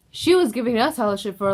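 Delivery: usual speaking voice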